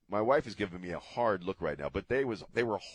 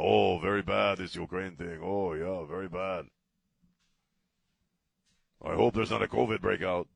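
mocking Xi; 4 dB louder than usual. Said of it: "Xi's, like, B S and Biden".

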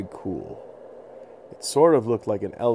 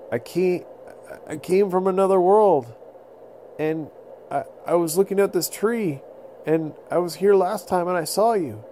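calm the beast down, pitched up; pleading little boy; 8 semitones higher, 3 dB louder than usual. Acted. Little boy pleading with the mammoth not to charge.

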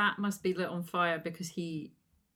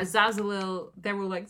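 ordinary delivery

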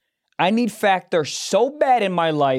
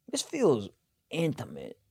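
soft, halting acted aside, dropping lower; uneasy murmur; 3 semitones lower, 10 dB quieter than usual. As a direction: murmur it with awkward pauses. Acted uneasy morning-after thought.

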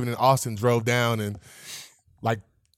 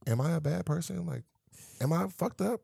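soft, higher self-questioning; small, hushed worried questions; retold self-doubt; 3 semitones higher, 10 dB quieter than usual. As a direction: ask yourself quietly. Retelling self-doubt after reading Twitter.

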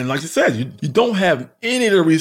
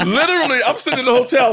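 laughing; 7 semitones higher, faster than usual. Real, not acted. Laughing retelling being stranded.

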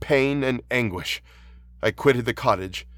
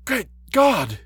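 put-on high-pitched old man's startled outcry; aghast old man, pitch leaping high; shocked exclamation; 13 semitones higher, 4 dB louder than usual. A narrator's reading. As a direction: exclaim in alarm, voice shooting up. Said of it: "exclaimed the old man".

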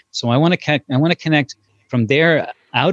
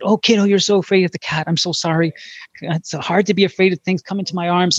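tender comforting; 8 semitones higher than usual. Retold or imitated. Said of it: "I said".

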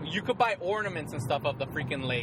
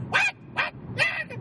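squeaky repeated what; slower than usual. Retold or imitated. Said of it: "She's like".

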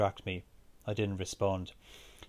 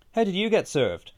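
high, louder disbelieving question; incredulous; 10 semitones higher, 6 dB louder than usual. A narrator's reading. Rob to Aisling arriving with pints, incredulous. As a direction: ask it in sheer disbelief, pitch leaping up. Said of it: "Rob asked Aisling incredulously".

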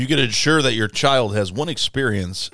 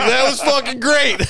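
much higher and louder, laughing through the words; Todd, laughing hard; 7 dB louder than usual. Retold or imitated. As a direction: gasp it out through a belly laugh. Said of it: "He's like".

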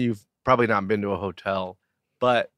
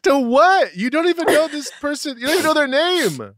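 much higher, fast put-on scolding voice; mock outraged; 4 dB louder than usual. Co-host mock-scolding his friend for a crush, in a high put-on voice. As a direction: screech at him like a fussy housemate.

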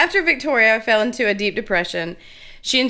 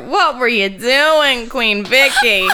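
put-on slow, drawn-out New Jersey accent, pitched up; 2 semitones higher, 4 dB louder, slower than usual. Co-host teasing Queen Victoria in a New Jersey accent. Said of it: "your Jersey accent".

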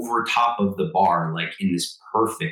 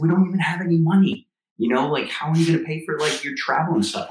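exasperated wife; 9 semitones higher than usual. Retold or imitated. Wife protesting that they have no money for his travel, pitched up and exasperated. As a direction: voice the wife's alarmed protest, pitched up.

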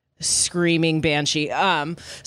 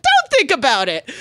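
teasing quote; 7 semitones higher, 5 dB louder than usual. Retold or imitated. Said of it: "And I was like".

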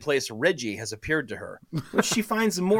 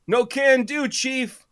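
stoner drawl; 9 semitones higher, slower than usual. Acted. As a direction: hawk pills like a burned-out old dealer. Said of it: "washed-out stoner drawl".

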